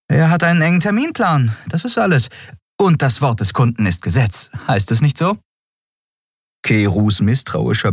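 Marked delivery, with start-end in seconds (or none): 0.1-5.4 high, brisk character voice; evasive Endriel. A narrator's reading. Endriel puts off questions about the customer, high brisk voice.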